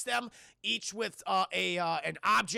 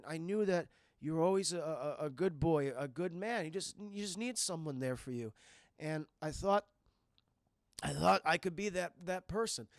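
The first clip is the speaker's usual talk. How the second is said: tender confession; 2 semitones lower than usual. Acted. Dying stepfather tells stepson he wanted to be his dad, tender. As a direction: play a dying man opening up gently.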